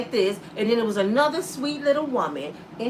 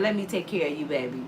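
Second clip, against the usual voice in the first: smooth seducer; 6 semitones lower, 6 dB quieter, faster than usual. Retold or imitated. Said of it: "a brother over there saying".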